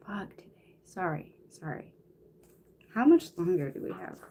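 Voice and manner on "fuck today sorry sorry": whispered curse under the breath; muttered curse in a whisper; whispered aside. Real, not acted. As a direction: drop to a whisper for the swear.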